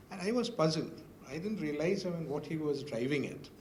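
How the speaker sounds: normal talking voice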